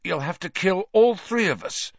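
weak. A narrator's reading. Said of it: "Ron said weakly".

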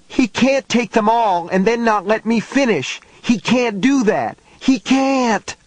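put-on a boy refusing to be stopped, near tears; desperate boy. A narrator's reading. Struggling boy protests, desperate.

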